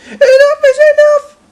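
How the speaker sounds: high, loud crying-out shout; Percy shouting. A narrator's reading. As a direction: yell it in a high strained voice. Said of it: "he shouted".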